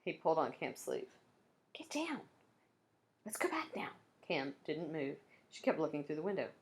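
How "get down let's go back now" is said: hushed, higher pleading; pleading under the breath; urgent whisper. A narrator's reading. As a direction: whisper it, scared of being caught. Hiding, urging friend to leave.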